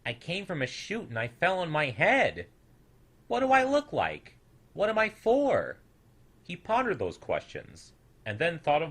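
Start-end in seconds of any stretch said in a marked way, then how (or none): 0.0-5.8 high, puzzled small creature voice; a tiny newcomer wondering aloud, pitched up; puzzled little snoodle asking himself; curious little snoodle. A narrator's reading.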